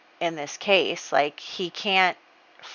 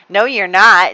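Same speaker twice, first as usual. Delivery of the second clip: flat denial; 10 dB louder than usual. Acted. Jay denies pregnancy.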